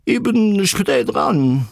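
high, drawn-out call from far above; stretched-out bird cry; croaking bird. A narrator's reading. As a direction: croak it slowly down from the sky. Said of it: "krächzte zu ihnen hinab".

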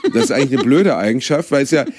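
ordinary talk, nothing marked

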